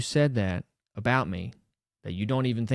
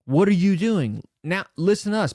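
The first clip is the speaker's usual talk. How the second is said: calling out, pitched up and louder; retold shout from the mat edge; 8 semitones higher, 5 dB louder than usual. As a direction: shout across to the wrestler, too late.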